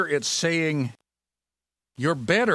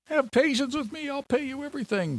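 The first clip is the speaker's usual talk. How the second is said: high, begging plea; pleading debtor; 14 semitones higher than usual. Acted. Fellow servant falling down before his creditor, high pleading voice. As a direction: beg for time like a desperate man.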